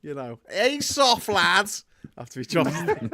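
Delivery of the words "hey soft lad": slow, high, loud Scouse wizard; Gandalf. Acted. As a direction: call out a drawn-out Scouse taunt. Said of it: "Gandalf just saying to".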